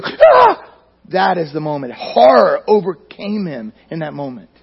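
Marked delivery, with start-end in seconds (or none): 0.0-0.7 sudden high, loud startled yelp; startled scream. Retold or imitated. Preacher acting out meeting a bear, a loud startled cry. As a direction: jump at the bear with a short cry. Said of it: "there's a giant bear and you go".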